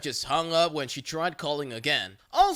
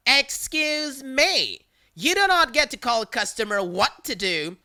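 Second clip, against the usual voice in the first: offended Karen; 6 semitones higher, 3 dB louder than usual. Retold or imitated. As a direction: stretch it out, outraged. Caller offended.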